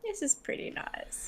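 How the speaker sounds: dreamy unvoiced whisper. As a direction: breathe it out in a blissful trance. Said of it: "in a nice trance state".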